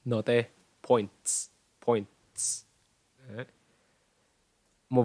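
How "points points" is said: points ending in a crisp "ts"; final TS sound. Shown as a demonstration. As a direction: finish points on a fused "ts", no extra vowel. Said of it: "Thành âm ts". (points, bật rõ âm cuối "ts")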